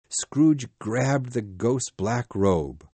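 usual speaking voice

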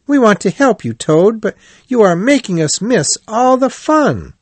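very high, lively chiding; cheerful. A narrator's reading. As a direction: scold a friend cheerily in a high voice. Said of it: "said Rat cheerfully".

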